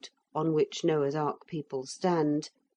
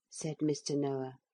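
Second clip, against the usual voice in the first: usual speaking voice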